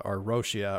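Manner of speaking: "Roshia" said low and soft with a Japanese-style sh sound; trying a variant name. Shown as a demonstration. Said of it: "or Rusia".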